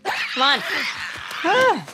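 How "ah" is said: sharp jolted cry of fright; startled yelp. Real, not acted. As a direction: yelp as if jumped at. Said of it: "Don't jump scare me like that".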